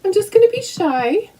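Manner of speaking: high little shy dog voice; voicing the dog. Voicing her dog's shy reply, high playful. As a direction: speak for the shy pup.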